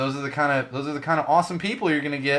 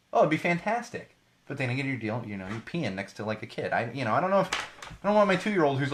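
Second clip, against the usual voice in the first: ordinary delivery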